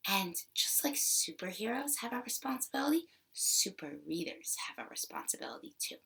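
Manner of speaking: hushed secret whisper. Acted. Secret whispered aside to kids.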